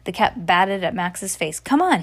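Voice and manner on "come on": high, loud command; ordering. Acted. Cat ordering Max to get up, loud command. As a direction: snap him into action. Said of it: "he ordered".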